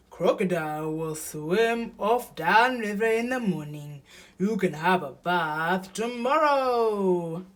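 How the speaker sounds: big animal cheering up a friend; reassuring Hippo. A narrator's reading.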